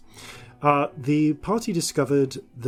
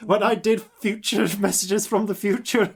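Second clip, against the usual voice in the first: higher, louder creaky elder; old man voice; 7 semitones higher, 5 dB louder than usual. Acted. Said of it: "the voice I used for Far Future [name]".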